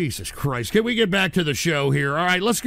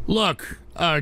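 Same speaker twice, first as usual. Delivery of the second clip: usual speaking voice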